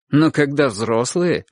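ordinary delivery, nothing marked

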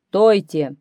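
commanding lion's shout; loud shout. A narrator's reading. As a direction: call the whole crowd to a halt. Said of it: "крикнул громким голосом". (громкий окрик льва)

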